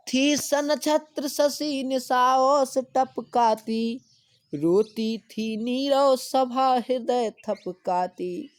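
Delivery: slow, high, loud declaiming; slow lofty verse reading; poem recitation. Acted. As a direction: chant the verse slowly to the class.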